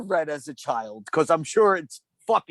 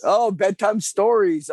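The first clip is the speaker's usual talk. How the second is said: slow, louder, higher echo; mock excitement; 6 semitones higher, 5 dB louder, slower than usual. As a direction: hype it up playfully. Echoing host announcement.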